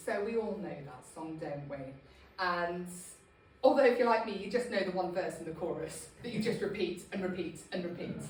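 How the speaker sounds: ordinary delivery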